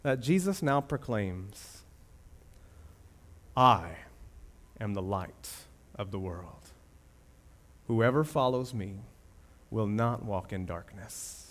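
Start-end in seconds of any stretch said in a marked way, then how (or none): 3.6-11.4 hushed solemn quote. Acted.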